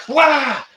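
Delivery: sudden high mock yell of rage; imitated outburst. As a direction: blurt out a frustrated wail. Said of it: "seen people lose their shit and be like".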